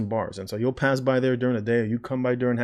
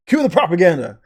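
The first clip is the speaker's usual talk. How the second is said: mock announcer; 6 semitones higher, 9 dB louder than usual.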